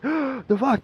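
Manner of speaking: high, loud, startled cry; shocked outburst. Retold or imitated. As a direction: blurt it out in shock. Brother realizes he killed.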